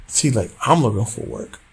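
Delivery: ordinary delivery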